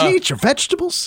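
high, fast mock coach; imitating the coach. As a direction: ask it like a fussy coach. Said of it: "I can see that guy doing that".

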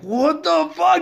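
a high, shocked "what the fuck" in disbelief; shocked outburst. Retold or imitated. Hearing his friend is with his girl, shocked and high. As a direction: blurt it out, betrayed.

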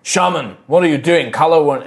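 protesting. Acted. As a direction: protest to your leader in alarm. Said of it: "begin to protest".

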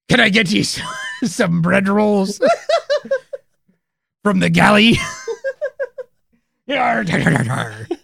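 high pirate voice breaking into laughs; laughing pirate voice. Pretend. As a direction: play a giggling pirate waiter. Pirate waiter offering rolls.

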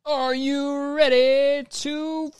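high, slow, drawn-out hype announcer. Pretend. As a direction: boom it out like a game show host. Hypes the advice segment.

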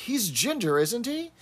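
high-pitched quoted question with a rising tag; Miss Brahms. Acted. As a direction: imitate her pointed, high-voiced query. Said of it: "Miss Brahms asks".

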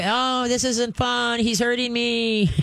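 put-on a kid whining about puppy bites; whiny kid. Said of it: "So they".

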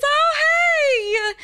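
mock-excited older man on the phone; imitating Mister Bill. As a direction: squeal his glad surprise at the call. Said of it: "you heard it".

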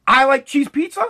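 ordinary talk, nothing marked

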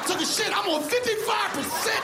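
mock complaint. Complaining about phone battery.

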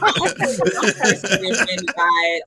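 slow, laughing fake outrage; laughing mock offense. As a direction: play offended while cracking up. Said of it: "In, in a serious note".

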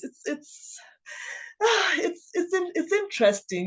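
high, faltering, emotional restarts; sighing, voice lifted high with emotion; real welling grief. Real, not acted. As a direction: struggle to get the words out through grief. Grieving speaker falters while reminiscing.

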